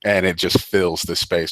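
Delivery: ordinary delivery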